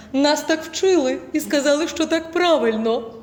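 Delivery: slow, hollow student voice; mock the student's put-on voice; that domed voice. Teacher imitates a student defending her hollow, slow voice. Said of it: "розмовляє таким голосом".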